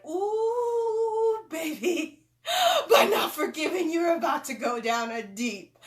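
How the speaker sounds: a spirit guide begging her to forgive; pleading spirit guide. Retold or imitated. Her spirit guide urgently warning her of a dark path. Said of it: "my own spirit said".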